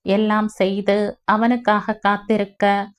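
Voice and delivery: ordinary delivery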